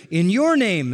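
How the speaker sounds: "your" punched high and loud; stress on YOUR. Shown as a demonstration. Preacher showing the emphasis in the verse, stress on "your". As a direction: lean hard on "your", then drop on "name". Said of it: "emphasis on the word your".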